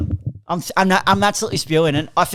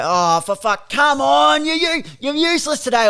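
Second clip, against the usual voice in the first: higher-pitched mock heckle; exasperated fan; 5 semitones higher, slower than usual. Showing how fans bag their own players, exasperated heckle. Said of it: "like when you go".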